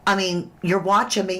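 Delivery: ordinary talk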